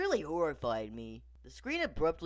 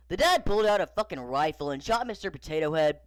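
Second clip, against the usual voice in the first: plain reading voice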